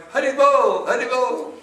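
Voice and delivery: high, loud bark in words; barking dog. Acted. Dog in the story chanting to the Lord, barking. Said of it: "sort of barking".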